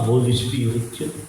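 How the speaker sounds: usual speaking voice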